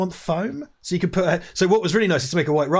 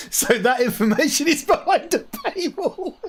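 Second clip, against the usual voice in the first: high, slow, laugh-filled delivery. Real, not acted. Host teasing a listener about paywall, high and laughing. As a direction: laugh your way through the punchline.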